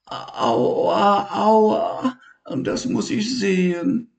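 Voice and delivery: high, slow and whimpering little creature; high, hurt little voice; Elmar's voice. Acted. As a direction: whine about the sore spot.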